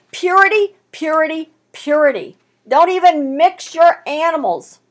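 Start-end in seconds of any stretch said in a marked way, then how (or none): 0.1-2.4 first "Purity" loud and higher, then falling; preacher pounding purity to the congregation; repeated purity. Acted. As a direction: drive purity home three times. Preacher chanting the word.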